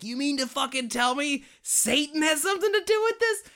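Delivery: high-pitched, mock-outraged disbelief; incredulous outburst. Film fan re-enacting their shock at the Satan reveal, incredulous outburst. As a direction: blurt it high, as if floored by a twist. Acting out her shocked reaction.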